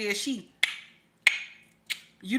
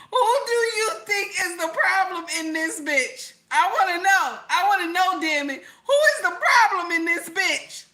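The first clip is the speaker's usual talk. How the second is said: louder, higher, insistent; excitedly demanding who the problem is; 7 semitones higher, 3 dB louder than usual. Real, not acted. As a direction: demand an answer, half laughing. Demanding answer from chat.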